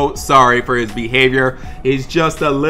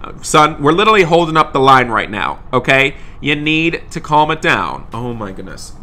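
ordinary talk

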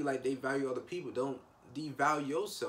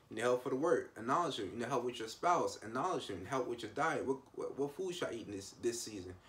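ordinary talk

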